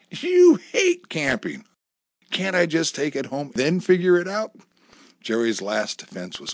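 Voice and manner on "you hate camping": skeptical retort. Acted. Wife rebuts husband's camping promise.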